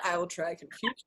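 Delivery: low, soft conspiratorial aside; hushed, mischievous aside; scheming aside. Acted. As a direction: drop the voice to plot to yourself.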